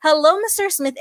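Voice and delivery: bubbly, high-pitched phone hello; high-energy greeting. Shown as a demonstration. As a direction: greet the caller with over-the-top enthusiasm. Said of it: "keep up the energy like".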